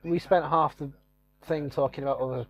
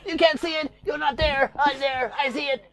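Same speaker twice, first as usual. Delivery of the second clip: much higher, wild ranting cult-leader voice; Charles Manson; 10 semitones higher, 3 dB louder than usual. Pretend. A cult leader shouting at his accusers in a high wild rant. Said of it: "Might talk like Charles Manson".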